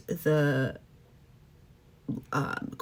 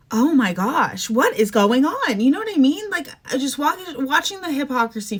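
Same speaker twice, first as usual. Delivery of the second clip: exasperated; 7 semitones higher, 5 dB louder than usual. Real, not acted. Exasperated rant about Canada.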